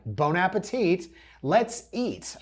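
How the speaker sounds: high, mock-cheery slogan reading; mocking sign slogans. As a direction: read it out with fake enthusiasm. Mocking staging signs' slogans.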